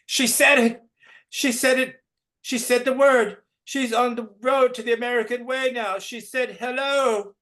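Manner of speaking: very high, loud triumphant cry. Acted. Teacher crowing over a pupil's hello, very high. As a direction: crow with triumph over the girl. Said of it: "yanks her to the front".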